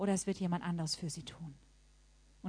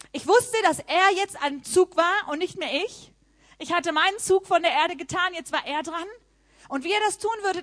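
loud, high, driving testimony; fervent preaching; 7 semitones higher, 7 dB louder than usual. Acted. Preacher telling the congregation God was now at work, fervent. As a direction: preach it with full conviction.

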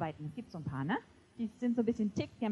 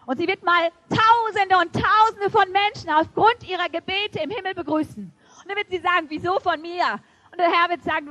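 rising sermon; 4 semitones higher, 9 dB louder than usual. Acted. Preaching at full force.

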